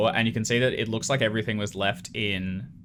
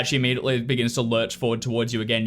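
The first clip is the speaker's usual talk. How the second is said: normal talking voice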